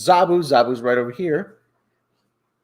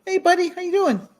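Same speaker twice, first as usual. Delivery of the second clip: pet talk. Real, not acted. Host greets his cat on camera, high cooing. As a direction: coo up high at the kitty.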